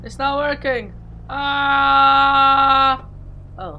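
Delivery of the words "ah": loud high yelp of panic; panicked scream. Real, not acted. Gamer screaming as his plane stops working.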